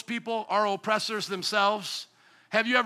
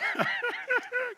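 high laugh at himself; 13 semitones higher, 6 dB quieter than usual. Real, not acted. Laughs at his own joke.

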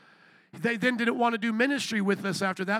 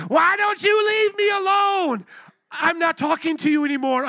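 mock exasperated shout; 6 semitones higher, 6 dB louder than usual. Retelling own reply to hecklers.

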